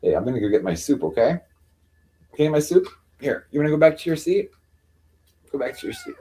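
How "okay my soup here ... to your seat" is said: high, sweet sing-song. Real, not acted. Telling her cat to go back to its seat, high baby talk.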